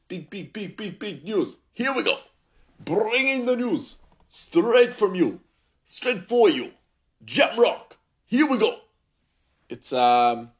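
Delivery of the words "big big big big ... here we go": loud, high hype-man announcement; hype announcer. Acted. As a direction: pump up the big news like a radio jingle. News jingle hype.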